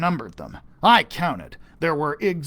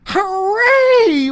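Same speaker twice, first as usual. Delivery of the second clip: high loud cheer; 10 dB louder than usual. Acted. Kids cheer after being saved, high loud cheer. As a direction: cheer with joy. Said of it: "we shouted".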